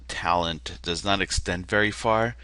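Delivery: ordinary delivery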